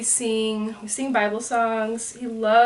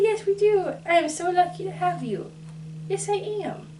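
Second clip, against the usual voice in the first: put-on baby talk; 9 semitones higher than usual. Mom speaking for baby.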